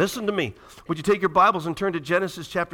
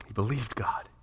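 whisper; 7 semitones lower, 7 dB quieter than usual. Real, not acted. Hushed climax of sermon.

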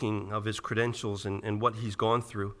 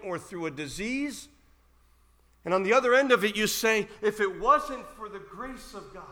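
loud, emphatic pulpit delivery, building higher; forceful preaching; 4 semitones higher, 6 dB louder than usual. Real, not acted. Preacher to church members who have gone through loss, forceful preaching. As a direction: press each hardship home to the crowd.

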